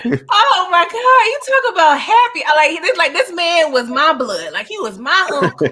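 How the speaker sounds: high, loud, crying-happy outburst; can barely hold it together with joy; overjoyed, near tears. Real, not acted. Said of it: "This is emotional".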